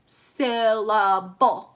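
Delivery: Syllable very slow, in three loud beats; over-enunciate each beat of Syllable; counted-out syllables. Shown as a demonstration. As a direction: pull Syllable apart as SYL, LA, BLE. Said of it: "how many syllables are in".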